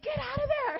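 high, loud shriek of warning; urgent yell. Retold or imitated. Sister to storyteller in the burning room, urgent high yell. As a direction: yell it as if someone is in danger.

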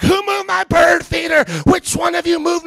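high, frantic mock yell; hammed-up high-pitched outrage; imitated yelling neighbor. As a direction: scold the kids like a crazed neighbor. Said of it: "she's yelling at my kids".

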